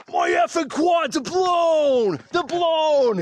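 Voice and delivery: put-on high-pitched, heavy New York accent; griping runner in a thick New York accent. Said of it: "It's like this heavy New York accent".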